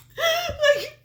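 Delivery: very high, loud, helpless laughter through words; real laughing. Real, not acted. Host retelling Kenny's baffled thanks, cracking up. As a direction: barely get it out for laughing.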